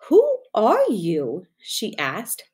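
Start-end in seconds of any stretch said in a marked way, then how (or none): 0.1-1.5 slow, wide-eyed wonder; surprised. A narrator's reading. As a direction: ask it in open surprise. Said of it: "very much surprised".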